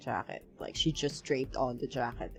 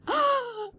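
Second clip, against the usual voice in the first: sharp high gasp; 4 dB louder than usual. Real, not acted. Startled by the scene.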